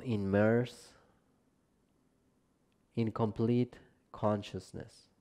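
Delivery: calm, measured relaxation guidance, phrase by phrase; guided meditation. Acted. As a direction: guide them gently down into rest, unhurried and spacious. Leads a yoga nidra practice.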